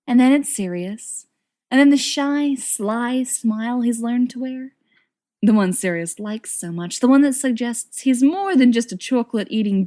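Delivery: ordinary delivery, nothing marked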